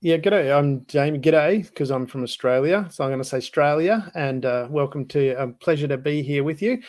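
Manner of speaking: jokey over-the-top Australian twang; silly Australian accent. Pretend. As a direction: play up a thick ocker Australian accent for fun. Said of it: "I'll stop that silly accent".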